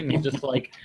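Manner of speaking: ordinary delivery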